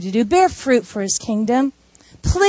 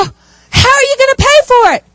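much higher and louder alarmed question; shocked coworkers; 11 semitones higher, 5 dB louder than usual. Acted. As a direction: sound aghast at the cost. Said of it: "They go".

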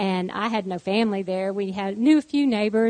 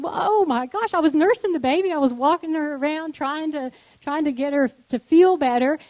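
high, urgent self-justifying protest; flustered defense; 6 semitones higher, 5 dB louder than usual. Retold or imitated. Nursing mother explaining herself to church lady, flustered defense. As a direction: explain yourself in a mortified rush of higher pitch.

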